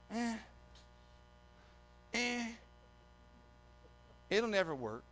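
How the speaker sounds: imitating an unimpressed congregation; grunt, wait, then softly write it off; quiet, grudging naysaying; dismissive grunts. Church dismisses the pastor's plan.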